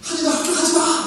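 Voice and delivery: high-pitched exasperated parent act; mock threatening. Parent threatening a child who won't get up, mock scolding. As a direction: threaten the child in playful imitation. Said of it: "협박하고".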